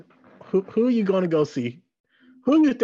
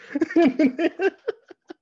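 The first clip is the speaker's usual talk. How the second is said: big laugh; 12 semitones higher than usual. Real, not acted. Laughing at own joke.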